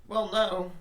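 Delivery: high, loud, emphatic correction; retold exasperation. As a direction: correct a clueless client with exaggerated force. Retelling a reply to a clueless client.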